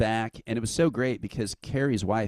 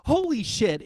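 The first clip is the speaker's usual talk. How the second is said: stunned gasp; 13 semitones higher, 3 dB louder than usual. Retold or imitated. Stunned by meeting Hanneman.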